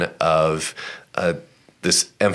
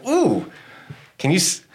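shocked. Retold or imitated. Reacting to Jesus' harsh words.

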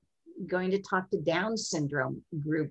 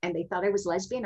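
normal talking voice

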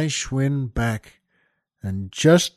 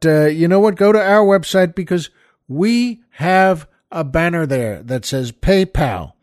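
normal talking voice

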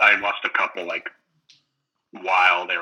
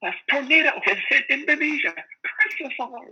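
mock scream. Imitating a nerdy kid throwing out alerts, high mock scream. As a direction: squeal it like a panicking nerdy kid. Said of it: "he just screams".

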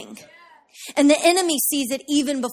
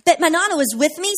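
ordinary talk, nothing marked

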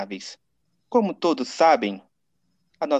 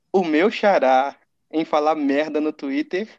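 ordinary talk, nothing marked

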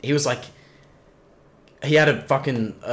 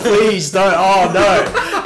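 high, loud fake terror over laughter; mock pleading; 6 semitones higher, 8 dB louder, much slower than usual. Sales rep taunting the tough guy, mock pleading. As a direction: beg in hammed-up fright.

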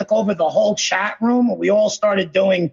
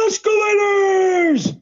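high, slow shouted "escalators"; SpongeBob shout; 8 semitones higher, slower than usual. Retold or imitated. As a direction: belt it out like a cartoon sponge.

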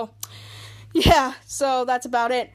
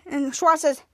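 ordinary delivery

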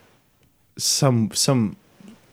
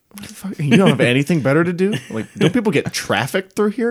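retold outburst; 6 semitones higher than usual. Retold himself arguing with guard.

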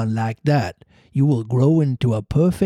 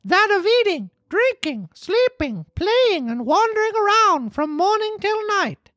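Pinocchio voice. A narrator's reading.

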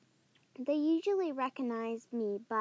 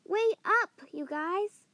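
shouting after friends; calling out; 5 semitones higher, 5 dB louder than usual. Acted. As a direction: call out to them.

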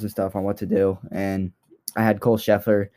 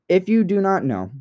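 normal talking voice

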